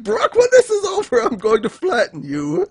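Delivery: high-pitched acted warning; mock threat. Voicing a crewmate threatening Brook, high mock threat.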